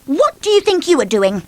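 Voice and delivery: high, loud, sharp demand; bossy snap. Acted. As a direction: snap like a strict headmistress. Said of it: "snapped Miss Bossy in that very bossy voice".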